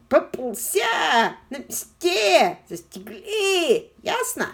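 high, slow, loud yelling; slow shouted accusation. A narrator's reading. Miss Trimbl yelling at the caught Jimmy, slow and loud. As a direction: spit each word out loud and slow. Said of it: "орала она".

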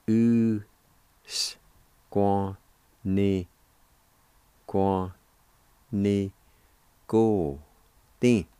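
"U, S, Kwa, Ni, go, tin", each sound alone and held; syllable by syllable. Shown as a demonstration. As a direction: break "U-S-Kwa-Ni-go-tin" into separated pieces. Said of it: "it's pronounced like this".

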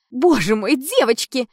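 put-on loud, high girlish squeal; excited. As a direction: gush with excitement. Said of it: "заёрзала на своём стуле Томилка".

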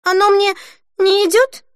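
high, taken-aback asking; dismayed question. A narrator's reading. Denise learning Holly dislikes the dress, dismayed. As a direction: ask it high, in disbelief.

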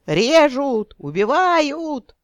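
high panicked screaming for help; yelling for help. A narrator's reading. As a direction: scream it at the top of your lungs. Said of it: "благим матом орал".

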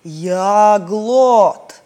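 lightly voiced muffled stone giant, slow; a hint of a dull stone voice; hollow stony voice. A narrator's reading. The island answers the trapped friends in a muffled stony voice. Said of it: "глухой каменный голос".

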